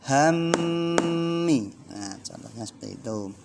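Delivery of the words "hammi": Hammi, mm ditahan lama dan tinggi; held gunnah. Shown as a demonstration. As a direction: dengungkan mm di Hammi dua ketukan. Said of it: "Ayo coba dibaca bareng-bareng". (Hammi dengan dengung panjang)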